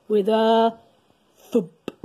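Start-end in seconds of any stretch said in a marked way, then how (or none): none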